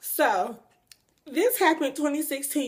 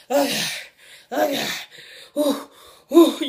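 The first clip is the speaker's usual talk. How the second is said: groaning in pain; 4 dB louder than usual. Retold or imitated. Sick on the toilet.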